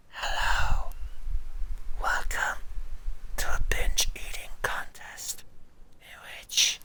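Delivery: exaggerated ASMR eating-show whisper; mock ASMR whisper. Streamer mocking ASMR eaters welcoming viewers, in a whisper. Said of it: "A S M R people eating loud into microphone".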